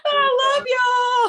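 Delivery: high, slow, loud fake sob; mock drunk wail. Teasing the group about Saturday, a mock drunk wail. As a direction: wail it like a tipsy crier.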